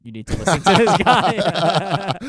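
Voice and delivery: loud, high, slow call breaking into laughter; hammed-up laughing shout; retold heckle. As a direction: pile on loudly, laughing at yourself. Retells himself joining the argument, laughing.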